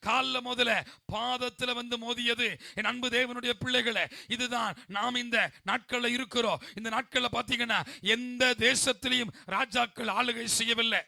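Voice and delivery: loud, driving sermon delivery; full-force preaching. Acted. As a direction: preach it out loud to the whole congregation.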